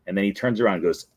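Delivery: normal talking voice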